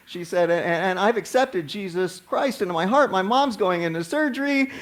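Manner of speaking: high, anxious, pleading retelling of the daughter; a lightly imitated, scared daughter who begs the pastor for help. Frightened daughter pleads with chaplain.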